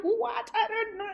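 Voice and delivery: high, tearful what, I don't know; stressed crying. Retold or imitated. Lost girl to helper, high tearful confusion. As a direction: whimper it out helplessly. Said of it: "I was just too stressed".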